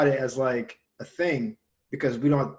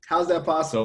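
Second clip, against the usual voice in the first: retold disbelief; 3 semitones higher than usual. Retelling his college days to a friend, incredulous. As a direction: ask it as if you can't believe it. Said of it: "And I was like".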